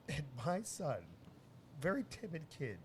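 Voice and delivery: normal talking voice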